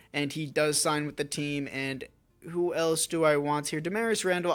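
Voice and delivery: usual speaking voice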